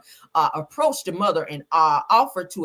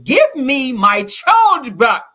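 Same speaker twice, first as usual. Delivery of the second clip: loud, slow, higher mock yell; 6 semitones higher, 10 dB louder, much slower than usual. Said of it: "Agba began yelling".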